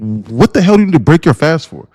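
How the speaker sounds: ordinary delivery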